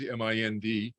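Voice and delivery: each letter M I N D said separately; spelled out. Shown as a demonstration. Teacher spelling out M I N D for students, letter by letter. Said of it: "four letter word mind".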